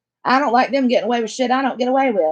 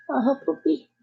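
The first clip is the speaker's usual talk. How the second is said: laughing coo; 4 dB quieter than usual. Real, not acted. Grandma laughing with the baby, high and giggly. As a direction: giggle along with the little one.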